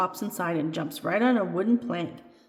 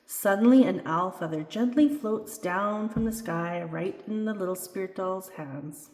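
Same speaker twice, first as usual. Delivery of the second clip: ordinary delivery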